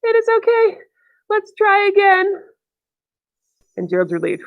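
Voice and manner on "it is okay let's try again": reassuring little storybook snake, high-pitched; snake character voice. Acted. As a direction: pitch it up high and stretch the words. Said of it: "The snake says".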